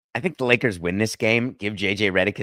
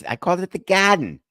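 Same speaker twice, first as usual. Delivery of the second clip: "Garden" as "Gahden" in a Boston accent, pitched up; 9 semitones higher than usual. Shown as a demonstration. Host defending his word to his producer, Boston accent. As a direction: drop the R in "Garden", Boston style. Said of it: "trying to do my Boston accent".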